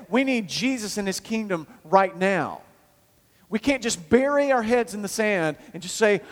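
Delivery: rally the congregation hard; full-force preaching. Acted.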